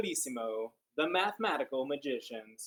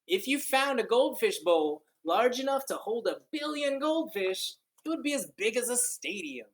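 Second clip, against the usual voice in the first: read-aloud storytelling; 9 semitones higher, 5 dB louder than usual. Acted. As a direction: read it like a wondrous tale to kids. Reads picture book aloud.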